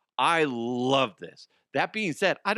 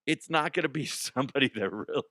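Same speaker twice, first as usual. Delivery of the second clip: normal talking voice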